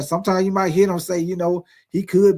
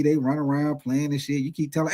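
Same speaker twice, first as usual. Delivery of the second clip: ordinary talk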